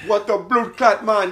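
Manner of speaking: exaggerated, drawn-out Jamaican accent; slow mock Jamaican outburst. Comic imitates the Jamaican woman at the door, Jamaican accent. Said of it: "like, Jamaican or some shit".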